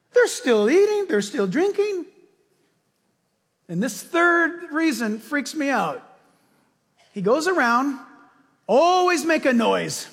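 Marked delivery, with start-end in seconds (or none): none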